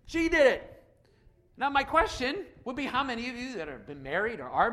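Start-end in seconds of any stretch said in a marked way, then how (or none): 0.1-0.7 high-pitched insistence on blame; acted, raised-pitch finger-pointing; accusing. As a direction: insist that it was her fault. Adam repeats the accusation.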